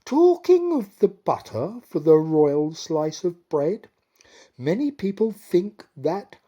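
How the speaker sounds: high, loud wheedling queen; queen tactfully coaxing the king. Acted. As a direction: coax him gently toward it.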